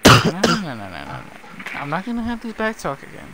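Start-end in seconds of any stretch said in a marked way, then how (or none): 0.1-0.6 high sharp shouted no; loud scolding no. Pretend. Mock scolding teammate's back talk. 1.7-3.3 scolding parent voice. Pretend. Mock scolding teammate's back talk.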